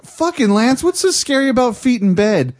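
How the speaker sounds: high, loud, incredulous complaint; mock exasperation. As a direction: scoff at a lame scare. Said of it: "we're all like".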